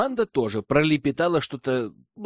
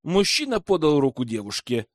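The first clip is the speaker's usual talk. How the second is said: ordinary delivery, nothing marked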